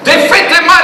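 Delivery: shouted high and loud; full voice recital. Retold or imitated. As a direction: belt it across the field. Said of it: "în grâu, să zici".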